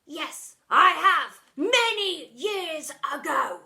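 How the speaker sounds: loud, then a slow whisper; shouted answer. A narrator's reading. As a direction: shout it, then hush the last words. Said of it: "shouted Miss Seeth".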